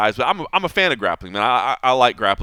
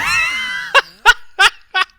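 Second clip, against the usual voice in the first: loud high shrieking laugh; 6 dB louder than usual. Real, not acted. Cracking up at his cohost's joke, shrieking laugh. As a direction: burst of squealing laughter.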